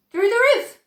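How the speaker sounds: gleeful announcement. A narrator's reading. Wonka answering Grandpa Joe, gleeful high call. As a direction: announce it like a great treat. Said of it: "said Mister Wonka".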